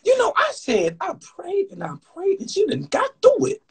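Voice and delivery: put-on high, wailing old lady voice; old church lady. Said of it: "act like the old ladies".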